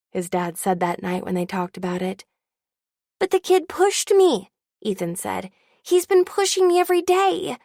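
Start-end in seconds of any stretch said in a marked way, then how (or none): 3.2-4.5 put-on high, boyish voice pleading his case; a kid defending himself, pitched up and louder; indignant young boy; young boy protesting. Kid Ethan protesting to his dad. 5.8-7.6 put-on high, boyish voice pleading his case; a kid defending himself, pitched up and louder; indignant young boy; young boy protesting. Kid Ethan protesting to his dad.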